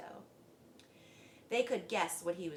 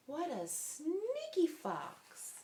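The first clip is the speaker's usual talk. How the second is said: mock shock; 9 semitones higher, much slower than usual. A narrator's reading. Narrator comments in shock.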